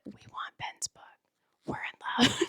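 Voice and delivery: a secret begging whisper to the author; whispered plea. Retold or imitated.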